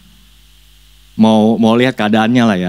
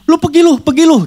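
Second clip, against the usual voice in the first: very high, loud order; demon voice; 3 dB louder than usual. Acted. Demon ordering the boy away, loud high voice. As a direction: drive him off. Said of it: "Terus tiba-tiba".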